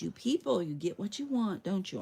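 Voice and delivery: ordinary talk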